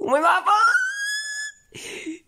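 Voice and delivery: very high, drawn-out shrieking cry; screeching wail. Acted. Son losing it with his father, high drawn-out screeching wail. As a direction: let it break into a shrill wail. Son's outburst escalates.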